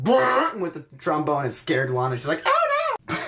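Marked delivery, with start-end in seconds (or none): 0.0-0.6 trombone noise. Retold or imitated. 2.5-3.2 very high falsetto squeal; badly faked high-pitched scream; squeaky Lana voice. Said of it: "What was that voice?".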